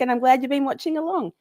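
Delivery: ordinary talk, nothing marked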